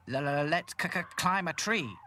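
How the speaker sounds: high, loud stuttering doll. Acted. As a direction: stutter on the first sounds, loudly. Hi-Fi's stuttering character voice.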